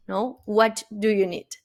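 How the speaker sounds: ordinary talk, nothing marked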